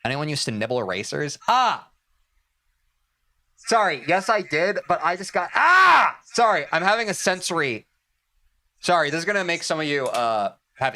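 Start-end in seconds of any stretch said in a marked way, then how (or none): 1.5-1.9 pained wince cry. Real, not acted. Wincing at thought of chewing. 5.5-6.2 sharp high pained yelp; sensitive teeth wincing. Real, not acted. Sensory pain, teeth hurt.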